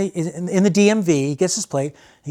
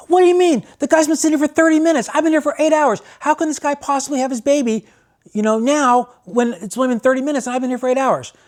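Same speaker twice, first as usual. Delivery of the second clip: a much higher, hammed-up incredulous rant; indignant protest; 9 semitones higher than usual. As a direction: play the fed-up father who's waited all night. Stranger protests to nurse.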